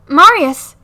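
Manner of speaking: loud call on the name. Real, not acted. Calling after Marius who ignores her, loud call. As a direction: shout to get his attention.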